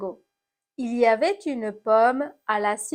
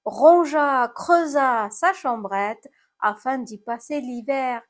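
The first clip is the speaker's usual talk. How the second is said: usual speaking voice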